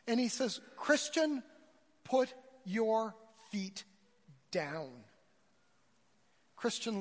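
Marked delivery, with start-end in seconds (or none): 0.8-5.1 firm instruction. Acted. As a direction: spell it out word by word to a panicking friend. Friend steadies drowning man in story.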